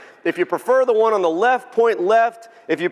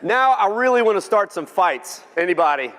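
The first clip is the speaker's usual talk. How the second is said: ordinary talk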